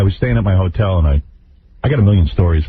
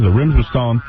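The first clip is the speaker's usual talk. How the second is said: normal talking voice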